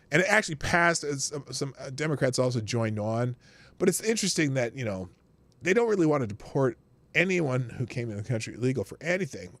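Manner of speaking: ordinary delivery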